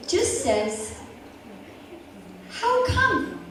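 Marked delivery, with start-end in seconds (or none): 2.5-3.4 bright, high friendly question; calm and friendly. Retold or imitated. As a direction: ask it sweetly, unbothered. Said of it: "She very calmly and super friendly".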